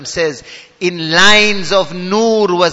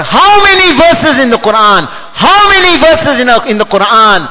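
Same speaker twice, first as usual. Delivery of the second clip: high, forceful rhetorical question; impassioned question; 6 semitones higher, 6 dB louder than usual. Real, not acted. Preacher pressing listeners after many verses, impassioned question. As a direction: fire the question out high and hard.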